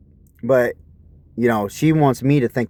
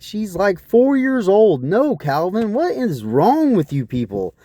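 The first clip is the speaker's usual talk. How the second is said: incredulous; 7 semitones higher than usual. Retold or imitated. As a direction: stretch it out in disbelief. Incredulous reply to gross joke.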